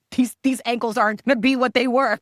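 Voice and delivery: high, loud, laughing half-wail; mock hysterical laughing panic. In the cold plunge, to her friend, mock hysterical laughing wail. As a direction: play up the panic at the icy stairs with a laugh. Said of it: "crying hysterical 'cause I'm like".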